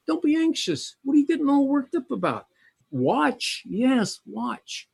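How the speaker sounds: gently mock a fussing child; God chiding his worried people, high and teasing; high coaxing scold. Preacher mimics God's gentle scolding.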